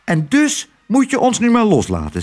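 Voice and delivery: hoge muizenstem, vastberaden. Acted. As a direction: een muisje dat afscheid neemt. Lodewijk neemt afscheid.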